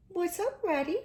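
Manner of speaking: a lightly high mole voice. A narrator's reading. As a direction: ask like a small nervous mole.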